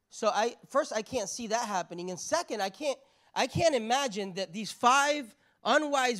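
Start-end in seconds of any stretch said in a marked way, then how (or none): none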